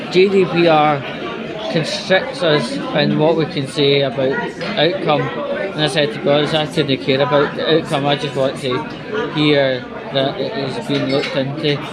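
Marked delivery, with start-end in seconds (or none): none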